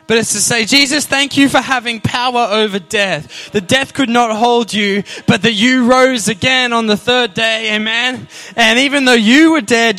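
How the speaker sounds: loud, rousing Easter preaching to the congregation; full-force preaching. Acted. Pastor stirs the congregation on Easter Sunday, rousing preaching. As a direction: lift the room, proclaim it.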